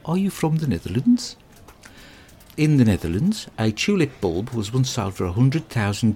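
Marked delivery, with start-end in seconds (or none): 0.1-1.4 higher, mock-polite question with a rise; mock-polite tourist asking a Dutchman. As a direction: put on a posh polite tourist voice. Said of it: "They prefer it if you ask them".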